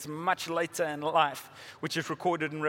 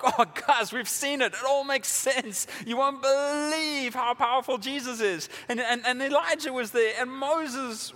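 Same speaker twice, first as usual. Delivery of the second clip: higher, louder, bursting with excited news; joyful excitement; 8 semitones higher, 3 dB louder than usual. Retold or imitated. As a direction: gush to friends about what you saw. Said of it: "Can you imagine their joy? They're like".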